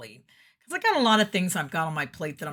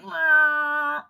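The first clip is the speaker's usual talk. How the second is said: high, loud, drawn-out "waah"; mock villain wail; 10 semitones higher, 7 dB louder than usual. Villain voice reacting to the valiant voice, mock high wail. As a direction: imitate a defeated villain crying out. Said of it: "the villain voice got".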